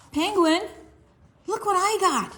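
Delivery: eager shout to a friend; Ella showing off her stickers; excited child. Acted. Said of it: "said Ella".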